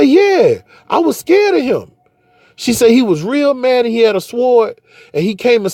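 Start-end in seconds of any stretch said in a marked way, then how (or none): 0.0-1.9 put-on much higher childlike voice admitting fear; a high child's voice, mildly frightened; scared daughter. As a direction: play the little girl owning up she was scared. Daughter recounting scary dream.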